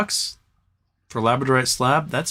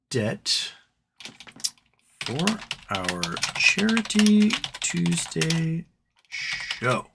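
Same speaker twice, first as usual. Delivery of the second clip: announcement read; 5 dB quieter, much slower than usual. Acted. Slow announcement of fundraiser.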